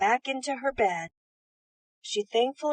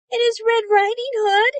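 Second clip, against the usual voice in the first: put-on much higher, louder little girl's call; cried aloud; 12 semitones higher, 9 dB louder than usual. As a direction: call out brightly through the door. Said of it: "she cried aloud".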